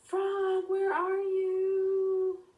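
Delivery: loud, high, long-drawn call; yelling. Acted. Boy on a hill yelling at the trees, high drawn-out shout. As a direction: stretch the words out across a distance. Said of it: "yelling at a group of trees".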